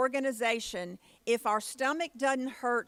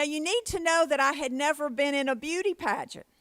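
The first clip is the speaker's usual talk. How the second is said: ordinary talk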